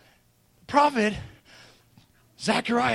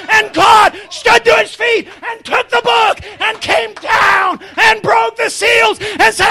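high, loud, pounding preacher's delivery; climactic preaching; 9 semitones higher, 11 dB louder than usual. Real, not acted. Preacher telling how God claimed his family, triumphant. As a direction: drive each phrase home at full voice.

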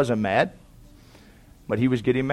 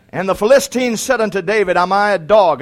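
loud, raised preacher's cadence; preaching; 6 semitones higher, 9 dB louder than usual. Acted. Preacher reading scripture to the congregation, loud and raised.

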